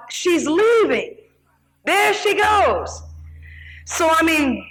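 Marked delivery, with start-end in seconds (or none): 0.1-3.0 mock yell. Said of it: "somebody yelled out".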